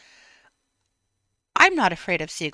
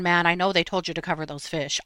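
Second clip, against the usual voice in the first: ordinary talk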